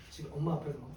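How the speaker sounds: ordinary talk, nothing marked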